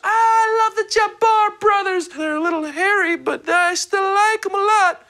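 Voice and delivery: very high, slow swooning fan voice; gushing fangirl. Retold or imitated. Fan gushing over the Jabbar brothers, high and swooning.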